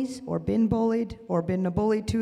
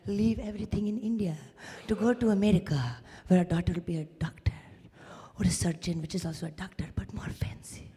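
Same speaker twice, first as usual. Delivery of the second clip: hint of immigrant parent, trailing softer; lightly voiced parents; 2 semitones lower, 5 dB quieter than usual. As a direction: lightly imitate the parents' earnest ambition.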